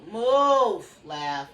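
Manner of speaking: sharp loud command. Real, not acted. To Lavin crowding her space, a loud snapped order.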